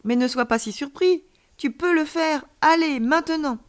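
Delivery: high, eager cheering-on; urgent encouragement. A narrator's reading. Alien child tells surprised friend to try, encouraging.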